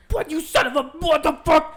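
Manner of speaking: high, loud outraged yelling; retold angry yell. Imitating the other driver cursing at them, high angry yell. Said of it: "and she was like".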